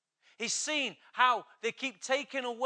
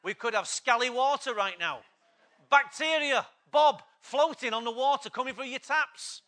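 preaching; 5 semitones higher, 7 dB louder than usual. Acted. Preaching at full force.

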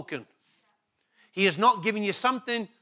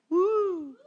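excited whoop; 11 semitones higher than usual. Real, not acted. Cheering Grace's move to Australia.

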